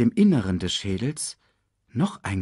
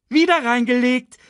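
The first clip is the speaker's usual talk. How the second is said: high, loud teasing crow; gleeful shout; 7 dB louder than usual. A narrator's reading. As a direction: crow triumphantly at fooling him. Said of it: "rief sie".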